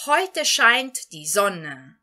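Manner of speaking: German read very slowly and clearly; slow model sentence. Shown as a demonstration. Teacher models the German sentence for her students, very slowly. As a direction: model each word slowly for learners. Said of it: "Então, nós temos aqui".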